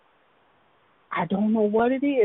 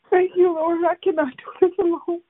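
higher, crying voice breaking as she thanks the Lord; tearful prayer; 7 semitones higher, 5 dB louder than usual. Real, not acted. Woman breaking down in prayer to God, crying. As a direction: let the tears come through each word.

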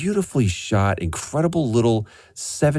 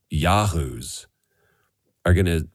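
usual speaking voice

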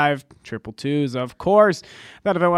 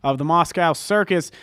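upbeat promo read, higher and louder; excited reading; 4 semitones higher, 4 dB louder than usual. Shown as a demonstration. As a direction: sell the event with excited energy. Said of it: "I was reading it excited because it had me excited".

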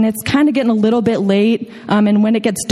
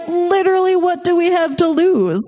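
mock carefree; 9 semitones higher than usual. Mock carefree reasoning to themselves.

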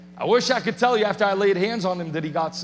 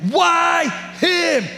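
loud, high, slow pleading shout at God; desperate shout of protest to God; shouted plea; 7 semitones higher, 5 dB louder, slower than usual. Retold or imitated.

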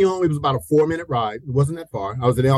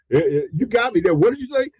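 stammered, then higher-pitched disbelief; taken aback; 7 semitones higher than usual. Retold or imitated. Young man questioning the driver's message, taken aback. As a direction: re-enact being caught off guard. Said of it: "taken aback".

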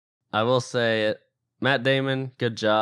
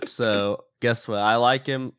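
ordinary talk, nothing marked

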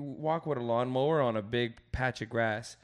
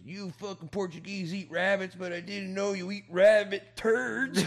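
put-on whitest old man; 6 semitones higher, 5 dB louder than usual. Old coworker mocking the narrator's breakfast, lightly voiced old-man teasing. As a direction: tease him in a dry old-man jab. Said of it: "in the whitest old man way".

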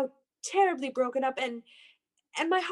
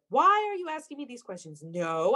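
ordinary delivery

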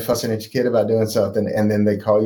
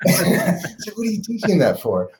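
exasperated grandmother; 12 semitones higher than usual. Retold or imitated. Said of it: "calls from his grandmothers".

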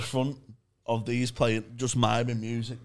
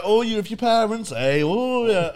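higher, louder gushing compère voice; mock sweet comedian; 9 semitones higher, 6 dB louder than usual. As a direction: coo at the teen couple, all sugary. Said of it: "she was like".

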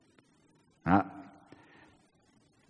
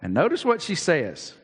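normal talking voice

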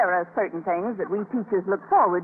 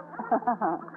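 innocent little laugh; 7 dB quieter than usual. Acted. Teacher laughs off contest accusation.

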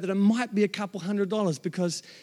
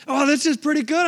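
pleased surprise; 6 semitones higher than usual. Retold or imitated. Retold delight at windfall.

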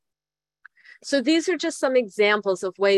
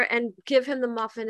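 ordinary talk, nothing marked

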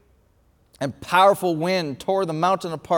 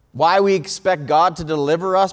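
ordinary talk, nothing marked

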